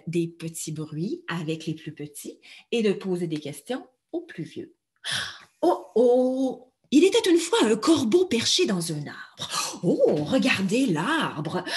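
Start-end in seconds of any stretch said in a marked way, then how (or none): 5.6-9.8 lively read-aloud for toddlers; story narration. Shown as a demonstration. Educator opening a tale for children, lively voice. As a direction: tell it brightly to little ones.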